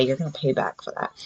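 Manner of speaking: low, soft muttered warning; quiet threat. Retold or imitated.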